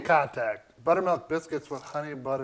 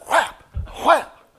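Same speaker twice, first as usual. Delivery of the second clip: slap sound; 10 semitones higher, 8 dB louder than usual. Acted. Mimics glove slaps for a duel.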